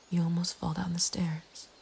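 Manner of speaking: plain reading voice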